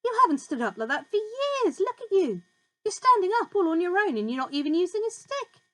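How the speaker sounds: put-on high, eager boyish cry; excited cry. A narrator's reading. George crying out to Grandma, excited. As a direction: cheer her on, amazed. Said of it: "George cried".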